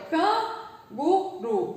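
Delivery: Canguru split into CAN, GU, RU; very slow, with gaps between syllables. Shown as a demonstration. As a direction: sound the word out piece by piece. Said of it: "Já separei aqui".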